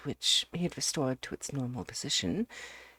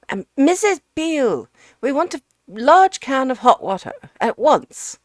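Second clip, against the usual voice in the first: much higher, louder call up the stairs; shouting for her; 11 semitones higher, 13 dB louder than usual. Acted. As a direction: bellow Missus Beale for the whole house. Ukridge calls the housekeeper.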